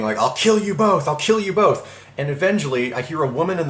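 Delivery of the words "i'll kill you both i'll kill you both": higher, repeated mock scream of threat; retold screaming. Enraged caller keeps threatening both, retold high screaming. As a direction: imitate a man who keeps screaming. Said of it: "he just keeps screaming".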